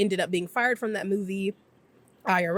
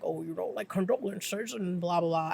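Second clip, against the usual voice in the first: a lightly done, softer Nixon impression; a grudging, half-hearted take on Nixon; 3 dB quieter than usual. Nixon calling Kennedy with fake condolences, a mild mock Nixon voice. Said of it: "I hate doing his voice".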